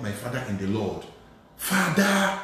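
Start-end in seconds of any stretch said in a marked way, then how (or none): 1.6-2.4 a touch higher, urgent calling; excited calling. Retold or imitated. Caller phoning his father in the Lord excitedly. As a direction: call out eagerly.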